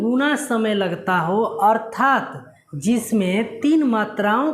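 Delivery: slow, careful dictation; teacher repeating the rule at a slow pace; slow repetition. Shown as a demonstration. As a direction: repeat the definition slowly and clearly. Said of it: "मैं फिर से बता दे रहा हूँ".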